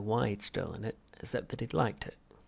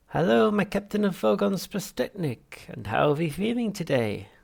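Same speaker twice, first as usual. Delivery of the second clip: much higher, louder unctuous therapist; Halfrunt voice; 10 semitones higher, 9 dB louder than usual. Acted. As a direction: greet the patient with oily cheer. Psychiatrist voiced by narrator.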